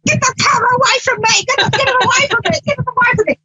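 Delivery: high-pitched playful yell at the camera; mock shriek. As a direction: squeal it like a camera-shy cook. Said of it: "she's like".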